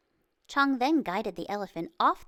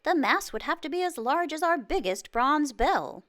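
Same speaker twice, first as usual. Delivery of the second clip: higher and louder call from the crowd; cried out; 8 semitones higher, 6 dB louder than usual. A narrator's reading. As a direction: cry it out above the others. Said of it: "Another voice cried out".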